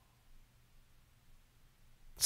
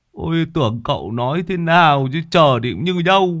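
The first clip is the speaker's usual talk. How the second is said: high, loud, mocking grumble; Long cheekily scoffs at his master, high and loud; cheeky sidekick; 9 semitones higher, 4 dB louder than usual. A narrator's reading. Long mouths off.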